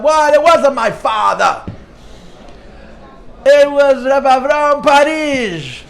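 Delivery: loud, high indignant outburst; blurted out loud. Retold or imitated. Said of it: "she blurts out".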